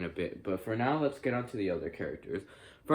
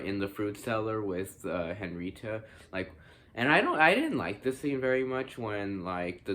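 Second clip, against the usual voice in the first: ordinary delivery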